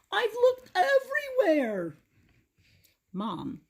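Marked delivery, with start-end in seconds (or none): 0.1-2.0 put-on high, lisping old man near tears; tearful toothless Grandpa. A narrator's reading. Grandpa tells Mom through tears, toothless and high. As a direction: sob it out through a toothless lisp. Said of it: "with tears in his eyes".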